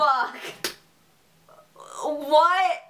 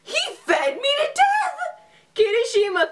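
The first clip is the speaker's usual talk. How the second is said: much higher, fast, crying-laughing yell; shocked shout; 12 semitones higher, 3 dB louder, faster than usual. Real, not acted. Player stunned that a sandwich killed her, shouting high. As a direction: scream in disbelief at the death.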